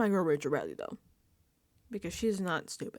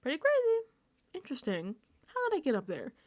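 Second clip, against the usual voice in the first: mock-curious needling, high at the start; lightly acted passive-aggressive wondering; passive-aggressive quote; 6 semitones higher, faster than usual. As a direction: imitate pointed musing meant to be overheard. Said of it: "it's a very passive-aggressive".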